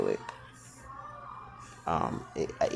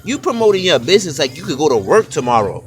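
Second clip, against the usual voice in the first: high and loud exasperated scolding; angry yell; 10 semitones higher, 9 dB louder than usual. Retold or imitated. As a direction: scold her like she's wasting your time. Yelling at a jobless Capricorn.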